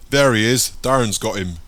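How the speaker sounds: loud triumphant call across the alley; shouting. Acted. Gang member calls to his mates, loud shout. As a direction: yell it out to the others, he's caught.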